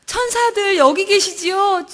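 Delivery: slow, high, wondering call; awed calling out. Retold or imitated. Calling to the angels in the storeroom, slow high wonder.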